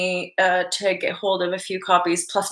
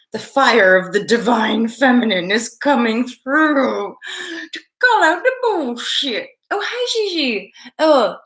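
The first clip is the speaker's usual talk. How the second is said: slow, drawn-out Southern drawl; Southern accent; 4 semitones higher than usual. Acted. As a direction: put on a playful Southern accent. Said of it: "apparently perfected the Southern accent".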